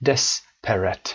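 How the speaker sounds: Desperate with stress on the first syllable, des, slow. Shown as a demonstration. Teacher modelling Desperate for students, first syllable stressed. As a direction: say Desperate slowly, hitting DES. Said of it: "مثل خود کلمهٔ desperate".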